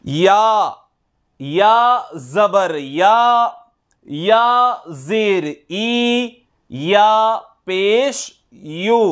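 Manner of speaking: slow, drawn-out chant that rises on each vowel mark; qaida recitation drill. Shown as a demonstration. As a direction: sound out each syllable for students to copy. (నెమ్మదిగా, రాగంతో అక్షరాలు పలకడం)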